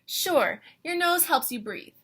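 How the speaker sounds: usual speaking voice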